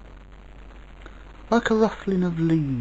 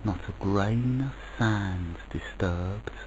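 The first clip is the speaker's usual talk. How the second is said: usual speaking voice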